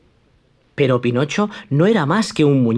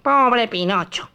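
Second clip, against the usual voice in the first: Pinocchio's threat; 10 semitones higher than usual. Acted.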